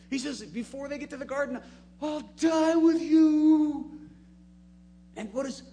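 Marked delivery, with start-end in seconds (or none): 2.0-3.9 slow, loud, high-pitched pledge; Peter's fervent vow. Retold or imitated. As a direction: drag out the promise with fiery conviction. Peter vows loyalty to Jesus.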